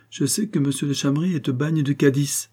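ordinary delivery

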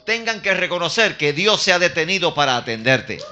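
high, emphatic, building to a loud cry; preaching at full force. Real, not acted. Preacher promising the youth God will vindicate them, rising full-force preaching.

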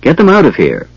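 mildly shouted order; restrained shout. A narrator's reading. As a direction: call out a command, holding back the shout.

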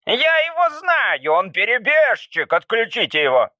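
student exposing a traitor; Anteater's voice. Acted. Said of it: "сказал Муравьед". (высокий обвиняющий голос Муравьеда)

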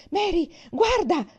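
high, loud urgent cry of alarm; loud frightened shout, pitched high. A narrator's reading. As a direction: yell to your sister in sudden fright. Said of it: "gridò".

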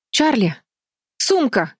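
high, sharply falling calls; urgent cry. A narrator's reading. Бетти calls to Чарли for the bag, urgent cry. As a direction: call out each word as an urgent order. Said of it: "крикнула Бетти".

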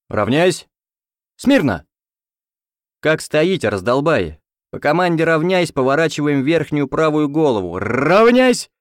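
barking parade-ground commands, very loud; shouting drill sergeant. A narrator's reading. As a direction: yell orders at the squad. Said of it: "Поорав как следует".

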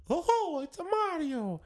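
cartoon Italian plumber way up high; Mario. Host trying his voice in the contest, high plumber impression. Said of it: "I'll do it in Mario's voice".

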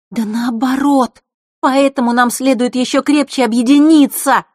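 high, loud, passionate plea; heatedly. A narrator's reading. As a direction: urge him with fire. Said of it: "горячо произнесла Василиса".

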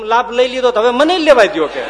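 loud, high, laughing demand; eager plea. Retold or imitated. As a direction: let me have my turn too. Brahmanand wants his turn. (હસતાં હસતાં આગ્રહથી માગે)

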